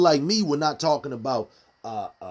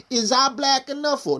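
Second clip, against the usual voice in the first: high put-on poetic voice, much higher than his talk; reciting own poem; 12 semitones higher than usual. Host quoting his own poem to viewers, high recital voice. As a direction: pitch it way up like a performed verse. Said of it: "I have a poem in that where I'm like".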